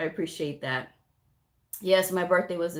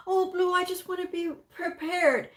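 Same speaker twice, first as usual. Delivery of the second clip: whiny student; 10 semitones higher than usual. Retold or imitated. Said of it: "student quoted".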